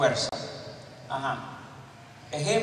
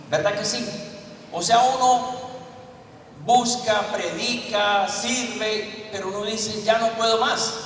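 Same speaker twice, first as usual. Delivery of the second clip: full-force preaching; 3 semitones higher than usual. Acted.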